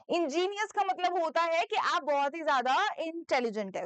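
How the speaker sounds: usual speaking voice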